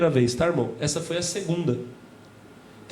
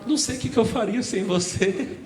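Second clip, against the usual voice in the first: sweet wife; 4 semitones higher than usual. Retold or imitated. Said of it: "acorda te dando beijinho".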